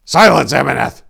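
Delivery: loud, firm order; steady him with command; firm loud rebuke; stoic command. A narrator's reading. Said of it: "as stoically as possible".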